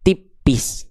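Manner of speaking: "tipis" dibaca tipis. Shown as a demonstration. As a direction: ucapkan "tipis" ringan dan tipis. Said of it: "Kalau ro-nya kasroh". ("tipis" dengan suara tipis)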